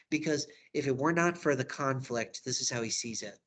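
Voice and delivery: usual speaking voice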